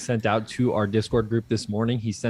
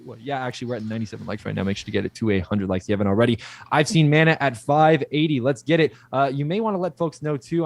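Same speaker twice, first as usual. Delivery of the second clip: ordinary talk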